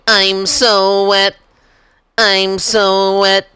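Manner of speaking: high, slow breathy-free mock moan "I'm so wet".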